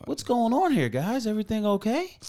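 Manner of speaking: high, bright manager voice; high customer-service voice. Retold or imitated. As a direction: sing it out like a polite manager. Manager mock-friendly check-in.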